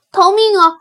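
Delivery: high, loud cry to flee; little rabbit yelling in terror; panicked shout. Acted. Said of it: "胆小兔叫道". (惊恐地高声大叫)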